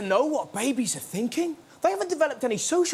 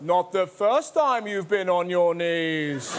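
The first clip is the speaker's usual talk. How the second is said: lower, slow sneering devil voice; 5 semitones lower, slower than usual. Acted. Devil taunting praying exorcist, low slow mockery. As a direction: tease him like a smug devil. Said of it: "a voice just goes".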